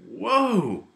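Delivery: high, loud startled "whoa", then baffled; stunned reaction. Retold or imitated. Retelling the group's reaction to the host, startled and baffled. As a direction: react like you just got ambushed. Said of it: "we all looked at each other and went".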